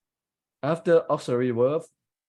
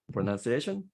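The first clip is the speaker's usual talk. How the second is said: usual speaking voice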